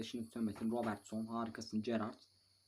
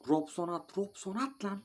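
rising higher, pressing call; urging shout; 9 semitones higher than usual. Real, not acted. Gamer urging his striker to shoot, a high pressing call. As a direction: push him to shoot now.

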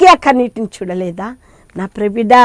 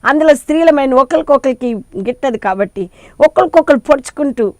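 ordinary delivery

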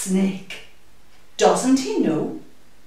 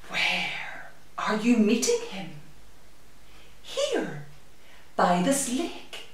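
unvoiced whisper, worried; hushed snake; 7 dB quieter than usual. Snake asking the mouse where, hushed whisper. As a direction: whisper it nervously.

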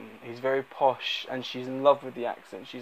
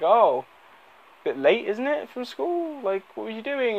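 much higher, sly mock-friendly neighbour voice; creepy neighbour; 10 semitones higher than usual. Neighbour teasingly stops girl crossing field, higher sly mock voice. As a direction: lightly play the creepy neighbour, pitching up.

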